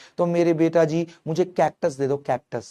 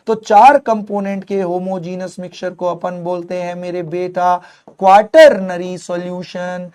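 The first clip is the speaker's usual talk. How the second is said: ordinary delivery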